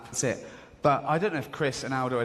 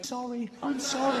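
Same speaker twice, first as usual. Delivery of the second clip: Louis apologising; 11 semitones higher than usual. Retold or imitated. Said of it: "he was going".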